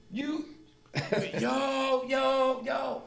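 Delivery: high-pitched drawn-out calls; acted calling out to a stranger. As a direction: hail someone along the tier, high. Acting out calls on the tier.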